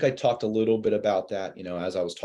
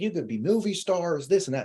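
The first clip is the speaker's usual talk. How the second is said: higher, gushing imitation of the wife; super excited wife; 8 semitones higher than usual. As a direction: play the thrilled caller, lightly imitated. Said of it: "she was super excited".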